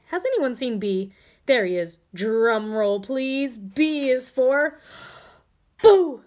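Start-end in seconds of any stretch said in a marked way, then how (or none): 5.8-6.2 loud high boo; big fright-night boo; scary boo. Acted. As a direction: jump out and shout it. B's big scare.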